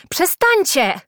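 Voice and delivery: high, loud yell; shouted protest. A narrator's reading. Julka yells at boys pelting her, shouting. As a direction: yell at them to stop. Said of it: "krzyknęła Julka".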